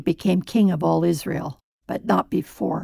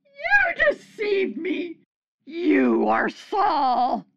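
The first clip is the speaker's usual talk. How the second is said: high, slow, crying out in shock; a wailing cry of betrayal; terrified scream; 10 semitones higher, slower than usual. Acted. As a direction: shriek it as she realizes she's been tricked. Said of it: "she screamed at Saul".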